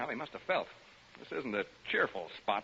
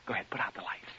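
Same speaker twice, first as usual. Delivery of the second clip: softer whispered repeat of the line; 5 semitones lower, 6 dB quieter than usual. Acted. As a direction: hush it to the listeners in the dark.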